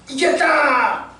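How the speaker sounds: high, drawn-out victory cry; triumphant cheer. Retold or imitated. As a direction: imitate a crowd celebrating its win. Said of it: "승리를 자축하지만".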